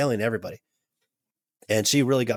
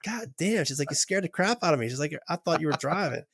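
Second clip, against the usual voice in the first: higher, flustered exclamation of relief; relieved exclamation; 6 semitones higher than usual. Retold or imitated. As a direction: let the scare drain out, voice pitched up. Therapist relieved after scare.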